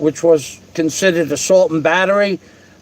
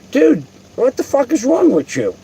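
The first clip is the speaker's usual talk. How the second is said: higher, incredulous exasperation; a retold baffled protest pitched higher; retold exasperated question; 5 semitones higher than usual. The teller, retelling how he asked the man who kept hitting him, as a higher baffled protest. Being punched, asks attacker why.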